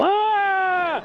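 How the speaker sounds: long, high-pitched "aah" scream; mock falling yell. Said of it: "and yell".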